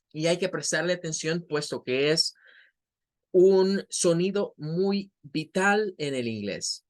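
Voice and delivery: usual speaking voice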